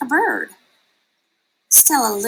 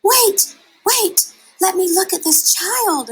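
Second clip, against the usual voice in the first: much higher, loud wait wait; urgent call; 10 semitones higher, 4 dB louder than usual. A narrator's reading. Mother bat pushing through the crowd to reach Stella Luna, urgent high call.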